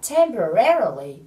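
"temporarily" with RA as the strongest syllable; stress on third syllable. Shown as a demonstration. Teacher pointing out the stressed syllable, "temporarily" stressed on RA. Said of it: "nhấn vào âm re ở âm tiết thứ ba".

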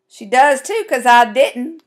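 loud, snippy sisterly comeback; snipped. A narrator's reading. Sister denying the theft to the girl, snipping. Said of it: "Kelsey Ann snipped".

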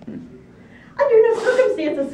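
very high, loud, slow veto; emphatic piggy squeal; squealed refusal. A narrator's reading. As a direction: squeal a panicked refusal, stretching it out. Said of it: "squealed two".

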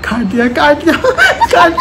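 loud laughing burst as the call drops; laughing and shouting that they hung up; laughing loudly. Real, not acted. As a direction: crack up as they hang up on you. Call cut, prank laughter.

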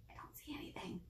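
soft unvoiced aside; whisper. Real, not acted. Librarian whispering to her friends at home. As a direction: whisper it like a secret. Explains she cannot see.

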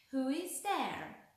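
imitating old lady. A narrator's reading. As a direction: draw it out, posing as an old lady. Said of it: "croaked the wolf, trying his best to sound like the old lady".

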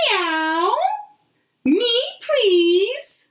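high squeaky cat begging; high little cat voice. Acted. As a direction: meow it up high, asking sweetly. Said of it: "says Cat".

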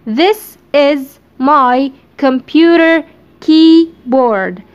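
This is My computer keyboard stretched out very slowly; slow dictation. Shown as a demonstration. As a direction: spell out the sentence slowly for students. (نطق بطيء جدًا للجملة)

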